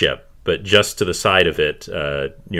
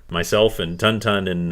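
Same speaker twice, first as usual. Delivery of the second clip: ordinary delivery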